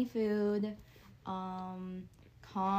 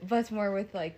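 ordinary talk

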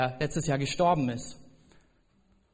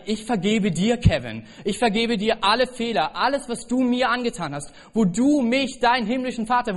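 God speaking; 7 semitones higher, 4 dB louder than usual. Acted. Voicing God forgiving the preacher.

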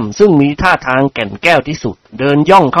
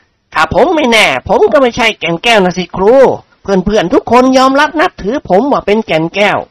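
higher, swaggering, menacing tone; threatening thug; 7 semitones higher than usual. A narrator's reading. Thug student threatening his teacher, higher swagger. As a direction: brag and threaten in the same breath.

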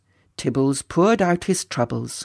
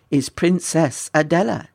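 plain reading voice

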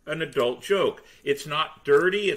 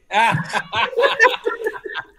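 loud, high belly laugh; real laughter; 8 semitones higher, 7 dB louder than usual. Real, not acted. Storyteller after the boy's answer, laughing hard. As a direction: burst out laughing after the punchline.

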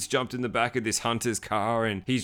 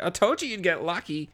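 mock receptionist; 7 semitones higher than usual. Vlogger voices the receptionist teasing the nerd: put-on voice. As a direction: play the smug receptionist. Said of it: "She's like".

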